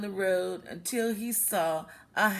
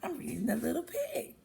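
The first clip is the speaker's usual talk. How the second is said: soft aside; 3 semitones higher, 7 dB quieter, much faster than usual. Real, not acted. Aside to someone else, soft.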